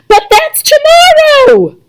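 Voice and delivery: high, slow alarmed cry. A narrator's reading. Mother realising the ticket date is tomorrow, alarmed high cry. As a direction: gasp it out in high disbelief.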